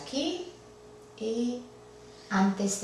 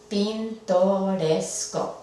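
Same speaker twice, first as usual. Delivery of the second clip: Pintoresco said slowly, syllable by syllable; slow syllable split; slower than usual. Shown as a demonstration. Teacher splitting the word for students, slow syllables. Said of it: "y entre estas dos".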